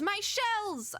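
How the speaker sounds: high, hammed-up protest; alarmed. Orbit protecting precious shells, alarmed.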